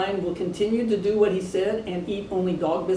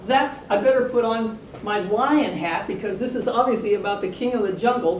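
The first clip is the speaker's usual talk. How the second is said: ordinary delivery, nothing marked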